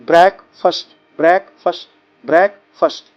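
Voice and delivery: ब्रेकफास्ट repeated as brekfus; pronunciation drill. Shown as a demonstration. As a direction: echo it three times. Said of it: "ऐसे".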